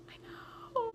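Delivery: barely voiced, awed reply; starstruck whisper. Answering Cone about Calgary, starstruck whisper. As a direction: breathe it out, overwhelmed.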